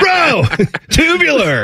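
very high Ninja Turtle surfer voice; surfer accent. Retold or imitated. Host joining the surfer joke, cartoonish surfer accent. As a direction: play a hammed-up surfer dude, way up high. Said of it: "You sound like a Ninja Turtle".

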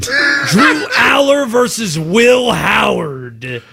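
drawn-out, emphatic delivery, every name stretched; grand mock billing. Real, not acted. Host belittling a national sports-talk debate, grand mock billing. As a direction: proclaim it slowly like a big matchup.

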